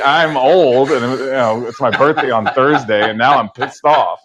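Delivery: high, loud opening settling into mock grievance; sarcastic thanks. Guest replaying reply to roommate's text about being old, sarcastic. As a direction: lay on the sarcastic gratitude, then grumble.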